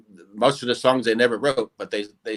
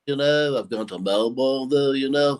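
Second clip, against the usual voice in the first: Liverpool cartoon drummer rambling on. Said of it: "[name] on the cartoon show".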